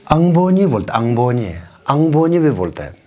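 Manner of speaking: अंगबोनी with a clear ng sound; ng variant. Shown as a demonstration. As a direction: press the ng in अंगबोनी. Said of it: "एनजी डालने का आदत है".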